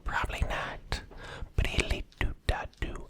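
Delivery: gentle bedtime ASMR whisper. Real, not acted. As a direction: whisper close to the mic, soothing. Whispers the whole ASMR video.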